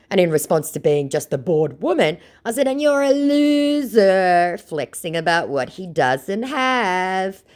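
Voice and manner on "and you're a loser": drawn-out high mocking jab; sing-song schoolyard insult; slow taunting sneer. Her taunting chat reply.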